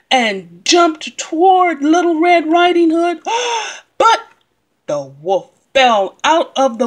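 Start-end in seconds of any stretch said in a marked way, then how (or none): 3.3-3.8 sharp gasp. Acted. Wolf jumps at her.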